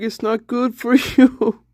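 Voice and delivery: usual speaking voice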